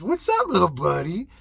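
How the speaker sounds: a hint of sing-song kid talk; cooing at kids. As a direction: coo at the little ones in the aisle. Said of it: "say hi to those kids".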